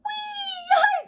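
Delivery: very high squealing whee; Froggy squeals riding fast; gleeful whee. Acted. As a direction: squeal with joy. Froggy delighted ride.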